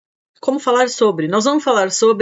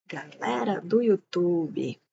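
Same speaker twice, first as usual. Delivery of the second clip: softer, slow, falling sing-song repeat; soft drawn-out echo; 7 dB quieter, slower than usual. Real, not acted. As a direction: echo the greeting quietly, stretching it out. Greeting YouTube viewers twice.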